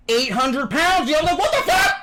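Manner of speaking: very high, loud squawk; incredulous outburst. Real, not acted. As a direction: stretch it out, then shriek in disbelief. Outburst about the date's size.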